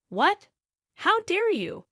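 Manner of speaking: high, loud indignant outburst; outraged. A narrator's reading. In-laws reacting to her refusal to clean, outraged. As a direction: explode in affronted disbelief.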